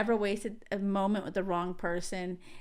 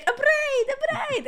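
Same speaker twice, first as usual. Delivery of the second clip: put-on high-pitched, terrible French accent; hammed-up French accent, pitched way up; bad French accent; 3 dB louder, slower than usual. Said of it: "the worst French accent".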